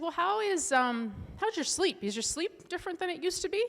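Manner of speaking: a hint of the doctor's gentle probing; quoting the doctor. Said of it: "And she said".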